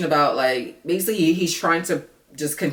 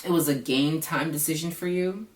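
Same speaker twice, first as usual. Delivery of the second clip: ordinary delivery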